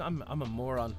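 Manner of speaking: normal talking voice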